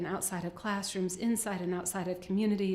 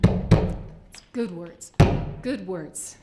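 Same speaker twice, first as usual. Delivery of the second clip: emphatic; 11 dB louder, much slower than usual. Real, not acted. Rousing the audience.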